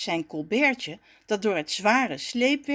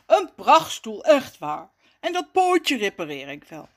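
lightly voiced placating figure; soothing; 5 dB louder than usual. A narrator's reading. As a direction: reassure the offended owner. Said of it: "haast Rommes zich Jacques te kalmeren". (sussend, licht aangezette stem)

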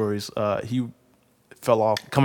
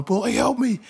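put-on much higher, louder kid yelling help; screaming for help; 13 semitones higher, 6 dB louder than usual. Boy yelling to cousin Pooh to help with the bike. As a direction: scream for your cousin like the bike is crushing you. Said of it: "screaming at Pooh to help me".

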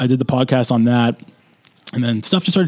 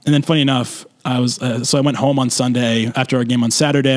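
ordinary talk, nothing marked

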